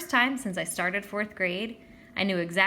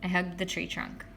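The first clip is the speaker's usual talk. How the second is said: ordinary delivery, nothing marked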